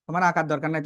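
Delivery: ordinary delivery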